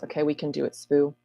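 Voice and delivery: usual speaking voice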